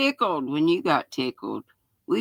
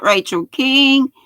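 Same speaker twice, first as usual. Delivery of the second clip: ordinary delivery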